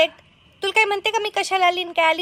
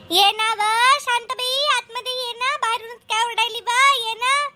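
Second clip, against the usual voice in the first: higher, friendly neighbour calling her in; 6 semitones higher than usual. Acted. Shantabai calling her friend inside, higher. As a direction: invite her inside, pitched up.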